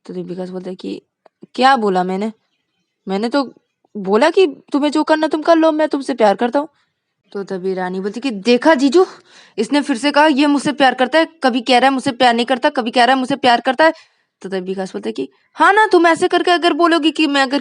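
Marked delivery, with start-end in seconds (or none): 1.5-6.7 loud, higher taunting repeat; defiant. Acted. 8.3-13.9 loud, high, fast grievance; indignant complaint. Acted. Rani complains to brother-in-law.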